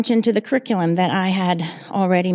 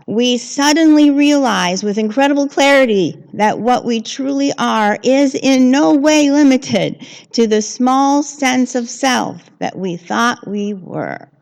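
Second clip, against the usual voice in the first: louder, higher preaching of the quote; preaching reread; 5 semitones higher, 8 dB louder than usual. Shown as a demonstration. Rereading the quote to the congregation, louder and higher. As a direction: proclaim it with full conviction. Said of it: "Read that again, the whole thing?".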